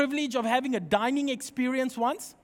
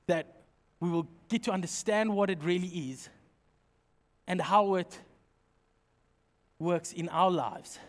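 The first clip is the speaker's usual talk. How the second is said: soft, lower prayer voice; 6 semitones lower, 5 dB quieter than usual. Acted. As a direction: pray quietly to the Father for the church. Leads the congregation in prayer.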